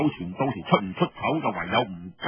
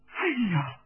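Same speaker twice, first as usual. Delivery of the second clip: higher, fretful female voice; anxious young woman; 6 semitones higher than usual. Acted. Hong Xuanjiao frets to Feng Yunshan, high and anxious. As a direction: fret that the joke's gone too far. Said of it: "洪宣嬌就好肉緊噉話啦".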